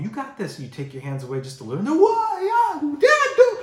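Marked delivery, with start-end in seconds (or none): 0.0-0.6 bright encouragement. Retold or imitated. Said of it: "parent encouraging". 1.8-3.6 shrill, high panicked shriek; screaming like a banshee. Retold or imitated. Kid losing balance on the bike, screaming like a banshee. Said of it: "screaming like a banshee".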